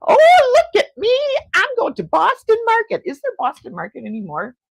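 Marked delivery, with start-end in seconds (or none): 0.0-3.0 high, loud mock bragging; over-the-top high-pitched teasing impression; imagined friend showing off. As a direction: crow it like a hammed-up show-off. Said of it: "You would be like".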